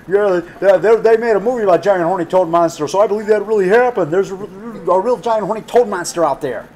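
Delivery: mock excited believer. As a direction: pitch it up and push it out like a gullible fan. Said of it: "I go".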